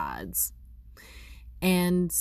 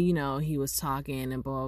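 ordinary talk, nothing marked

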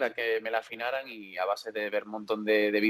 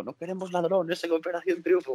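higher-pitched imitation of objecting viewers; mock public outcry; 7 semitones higher than usual. Singer retells the public's reaction to his casting, high mock protest. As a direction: mimic the indignant public in a raised pitch. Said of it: "la gente dijo".

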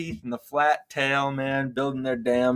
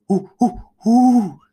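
high rising owl hoots; 11 semitones higher, 6 dB louder, slower than usual. Pretend. Host showing his friend the owl noise, high hoots. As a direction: hoot like an owl, climbing up. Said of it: "make the noise of each animal".

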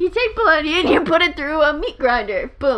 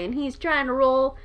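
usual speaking voice

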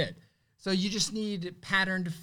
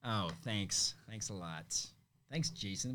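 dry, quiet sarcastic thanks; 8 semitones lower, 10 dB quieter, slower than usual. Real, not acted. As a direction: thank him without meaning it. Set up by a listener.

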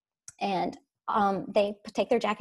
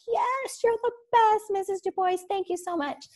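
put-on much higher, eager children's voice; excited kids; 10 semitones higher than usual. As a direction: gush like thrilled third graders. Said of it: "And they're like".

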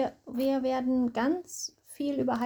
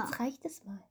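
whispered reprimand; 4 semitones lower, 13 dB quieter than usual. Acted. Bystander scolding Bartimäus.

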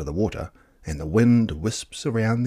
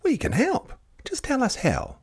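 put-on much higher, bright eager child voice. A narrator's reading. Child offering fairies help, eager and high. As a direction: offer help like a keen little kid.

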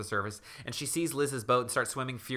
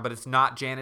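ordinary delivery

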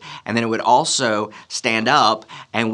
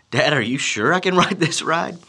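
higher-pitched imitated little boy; mock anxious kid, pitched up; doubtful child; 7 semitones higher than usual. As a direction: ask Dad for reassurance in a kid voice. Anxious son asks again.

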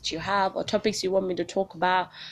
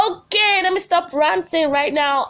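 laughing self-interrupt; 11 semitones higher, 7 dB louder than usual. Real, not acted. Cuts off her rant laughing.

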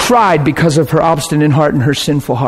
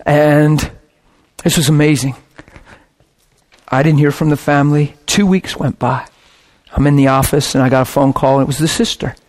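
ordinary talk

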